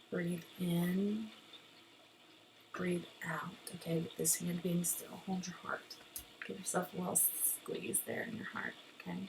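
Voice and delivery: soft, hushed breathing cues; guided breathing. Shown as a demonstration. Teacher shows students belly breathing, soft guided-breathing voice. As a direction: talk them gently through in and out, softly.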